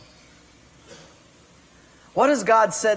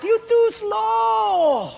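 high, very slow taunting shout; mocking Portuguese guy; much slower than usual. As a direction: drag it out to rub it in. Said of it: "that Portuguese guy laughed".

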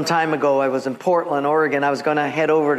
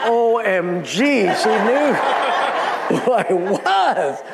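laughing disbelief; 3 semitones higher, slower than usual. Retold or imitated. Delighted surprise, laughing.

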